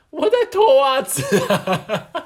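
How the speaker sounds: hammed-up childish answer; son's reply. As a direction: deliver the punchline as the kid. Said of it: "然后儿子就说".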